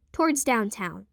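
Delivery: usual speaking voice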